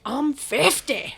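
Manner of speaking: high, slow character voice; drawn-out sketch catchphrase; Molly Shannon. Acted. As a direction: stretch it out as a proud comic boast. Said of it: "Molly Shannon never broke".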